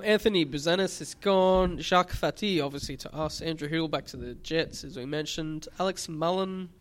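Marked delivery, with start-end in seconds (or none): none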